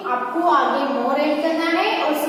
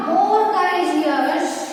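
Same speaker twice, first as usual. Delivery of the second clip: more industrious stretched out very slowly; slow dictation; much slower than usual. Shown as a demonstration. Teacher dictating comparative to class, very slow. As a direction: draw the words out for writing. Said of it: "courageous में भी क्या होगा".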